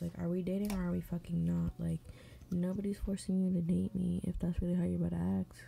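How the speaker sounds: ordinary talk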